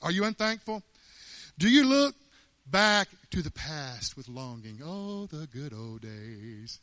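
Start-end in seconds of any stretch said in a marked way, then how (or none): 4.8-6.8 slow, dreamy, low ice cream commercial sigh; wistful ad voice. Retold or imitated. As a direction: drawl it like a nostalgic TV ad. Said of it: "like some ice cream commercial".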